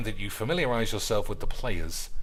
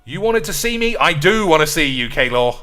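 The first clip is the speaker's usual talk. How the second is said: mock angry manager; 3 semitones higher, 7 dB louder than usual. Acting manager meeting player.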